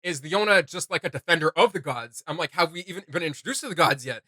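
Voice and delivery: usual speaking voice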